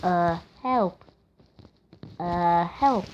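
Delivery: low drawn "Uh", then a separate "help"; mocking hesitant help. Gamer mimicking the game's cry, deadpan "Uh, help". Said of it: "who says, "Uh, help".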